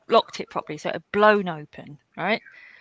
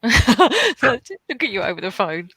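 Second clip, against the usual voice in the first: slow, laughing repeat of the phrase; 3 semitones higher, 6 dB louder, slower than usual. Real, not acted. To friends on stream, laughing at the phone exam. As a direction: savour the absurdity through a laugh.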